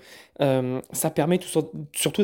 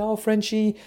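higher-pitched imitation of happy recognition; delighted greeting; 7 semitones higher, slower than usual. Americans recognising the French guy by his accent, imitated delighted greeting. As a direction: mimic a pleased "it's you!" greeting. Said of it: "ils sont super contents".

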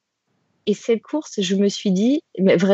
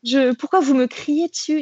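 mock tearful plea, pitched higher; a hint of crying in a higher voice; retold tearful pleading; 6 semitones higher than usual. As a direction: plead as if through tears, higher. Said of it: "je me suis mise à pleurer".

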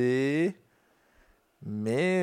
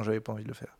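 usual speaking voice

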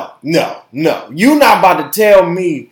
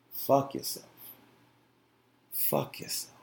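whispered, much softer swear; hushed curse; 6 semitones lower, 17 dB quieter than usual. Real, not acted. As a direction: breathe the curse out under the voice.

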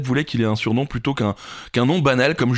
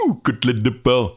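switching impression voices. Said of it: "qui fait la meilleure imitation?".

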